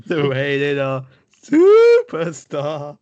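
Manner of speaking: slow booming ring announcer call. Retold or imitated. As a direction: draw out every word like an arena announcer.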